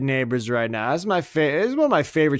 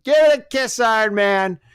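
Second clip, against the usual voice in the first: much higher, louder cheer at the screen; cheering shout; 9 semitones higher, 5 dB louder than usual. Pretend. Fan cheering Iron Man toward the kiss, loud. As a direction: yell it like a fan in the stands.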